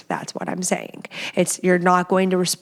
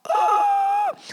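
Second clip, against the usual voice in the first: mock shocked gasp. Reacting to her own suggestion.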